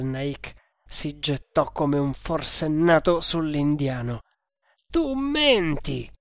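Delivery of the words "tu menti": high, drawn-out cry of accusation; high-pitched, slow outraged yell. A narrator's reading. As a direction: cry out in furious disbelief. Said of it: "urlò".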